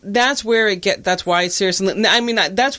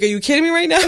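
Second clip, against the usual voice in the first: mock disbelief; 9 semitones higher, much faster than usual. Retold disbelief at the draft.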